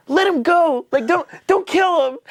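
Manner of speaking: very high-pitched imitated pleading; mock desperate plea. Chloe with a gun pleading for Aurelius, mock-desperate.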